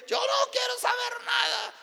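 high, piercing mock angry refusal; shouting. Husband refusing to hear the pastor, angry shout. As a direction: yell back as the furious husband. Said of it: "Otra vez me gritaba".

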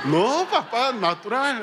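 high, loud, laughing correction; laughing daughter. Retold or imitated. Daughter laughing at her father's forced smile, high and giggly. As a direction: giggle while telling him to look natural.

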